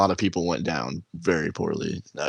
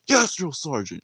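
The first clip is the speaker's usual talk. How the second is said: higher, snappy military answer; yes sir military replies; 8 semitones higher than usual. Retold or imitated. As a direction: answer like a recruit at attention. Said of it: "He's like".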